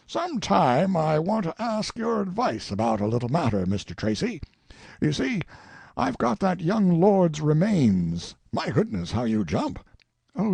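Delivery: put-on old colonel sizing up a face, mildly excited; lightly voiced old colonel. A narrator's reading. Colonel to Tracy about a portrait, lightly voiced old man. Sellers talks of lord's remains.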